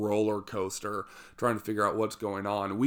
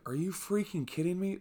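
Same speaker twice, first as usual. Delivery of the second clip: incredulous disbelief; 9 semitones higher than usual. Retold or imitated. Told to climb out exhausted.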